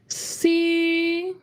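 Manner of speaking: C held at the top of the range; high tone on C, letting go at the end; high level tone. Shown as a demonstration. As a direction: hit C high and hold it. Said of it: "really hear it at the highest pitch".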